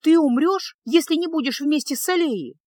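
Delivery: выше и громче, почти в отчаянии; в страхе за его жизнь выкрикивает; испуганный крик. A narrator's reading. Феска в ужасе кричит. (испуганный крик, выше и громче)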